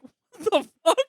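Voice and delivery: high, loud, disbelieving curse; shocked exclamation. Retold or imitated. To coworkers, retelling a friend's prank, shocked. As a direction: blurt it out in total surprise.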